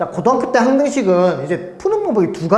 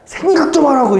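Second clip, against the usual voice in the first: higher-pitched retold teacher's scolding; exasperated scolding; 9 semitones higher, 4 dB louder than usual. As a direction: re-enact nagging a clueless pupil, pitched up. Retells scolding pupils who don't think. (답답해서 높은 톤으로 야단치는 재연)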